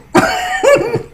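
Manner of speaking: short high laugh; real laugh. Real, not acted. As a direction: laugh at the joke about catching him. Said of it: "You know, I laugh a lot".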